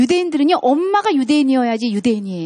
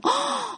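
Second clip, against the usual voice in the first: mock gasp; 13 semitones higher, 6 dB quieter than usual. Mother reacting to child.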